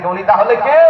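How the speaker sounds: "কে" climbing sharply high at the end; insistent rise to a high "কে"; prodding question. Real, not acted. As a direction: push the crowd to answer with a rising "কে". Presses audience for an answer.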